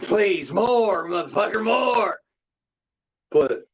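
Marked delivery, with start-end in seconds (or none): 0.0-2.2 high-pitched, taunting plea; mock begging taunt. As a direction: goad an attacker with exaggerated begging. Mock begging an attacker for more.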